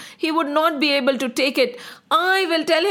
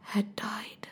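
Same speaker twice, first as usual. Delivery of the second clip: very soft, low whisper; grave whispered ending; hushed sorrow; 5 semitones lower, 13 dB quieter than usual. A narrator's reading. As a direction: let it fade to almost nothing. Announces the king's death.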